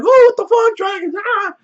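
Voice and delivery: high-pitched panicked crowd cries, hammed-up; mock freak-out. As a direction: imitate a crowd panicking at dragons. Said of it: "everybody just starts freaking out".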